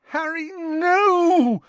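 a desperate wail; moaning Hermione. Acted. Hermione crying out to stop Harry, slow and anguished. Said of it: "moaned Hermione".

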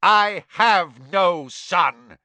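slow, loud, emphatic roar; furious bellow. A narrator's reading. Crouch disowns his son before the court, bellowing. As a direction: hammer out the rejection loudly. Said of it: "bellowed Mister Crouch".

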